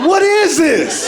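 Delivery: slow, high incredulous laugh. Real, not acted. Comic asking the audience in disbelief, slow and laughing. Comic reacting to bad weed.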